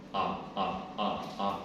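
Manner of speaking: low, short repeated "ah"; deep-pitched voice drill on "ah"; low vocal exercise. Shown as a demonstration. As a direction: sound each "ah" down in the deep register. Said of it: "teman-teman harus latihan".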